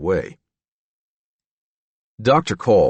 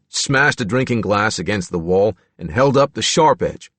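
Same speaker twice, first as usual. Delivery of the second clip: plain reading voice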